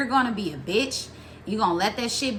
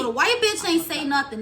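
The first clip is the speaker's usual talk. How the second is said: usual speaking voice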